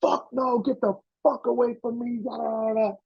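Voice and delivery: high, snapping impression; mock angry refusal. Star yelling at the PA, high mock voice. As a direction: brush him off in a high put-on voice. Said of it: "He's like".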